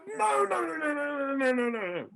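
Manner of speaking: very high, urgent run of no; exasperated maestro. Retold or imitated. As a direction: cry out no no no in frustration. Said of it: "She says / He goes".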